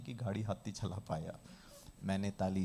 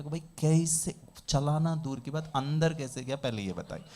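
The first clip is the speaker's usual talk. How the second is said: raised, louder incredulous question; mock bafflement; 5 semitones higher, 6 dB louder than usual. Teacher asking for baffled participants how it got in, mock disbelief. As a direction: demand an explanation in comic disbelief.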